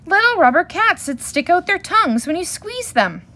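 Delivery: high, loud excited cub voice; excited shout. A narrator's reading. Cubs showing their parents a toy, shouting excitedly. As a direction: call it out like a thrilled child. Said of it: "shouted the cubs once again".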